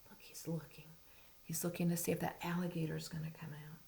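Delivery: fast excited whisper. Real, not acted. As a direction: whisper it like a secret. Suspense while showing pictures.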